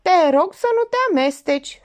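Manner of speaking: high, loud rebuff; snapping objection. A narrator's reading. Moraru tells her not to interfere, snapping. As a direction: jump in and shut her out. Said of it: "sări Moraru".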